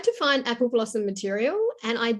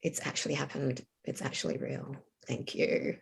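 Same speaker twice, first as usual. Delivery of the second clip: much softer, whispered relief; awed whisper; 6 semitones lower, 9 dB quieter than usual. Retold or imitated. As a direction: breathe it out like a quiet thank-you. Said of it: "And it was like".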